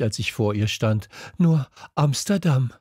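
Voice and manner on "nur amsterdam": panting. A narrator's reading. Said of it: "keuchte ich".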